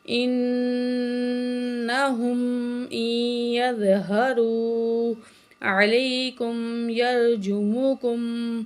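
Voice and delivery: slow, melodic Quran chant; full verse recitation. Shown as a demonstration. Teacher recites verse nineteen of Kahf, slow chant. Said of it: "تو مکمل آیت پڑھتے ہیں".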